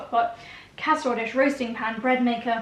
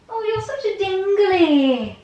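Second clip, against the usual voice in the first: put-on baby talk to dog; 9 semitones higher, 6 dB louder, slower than usual.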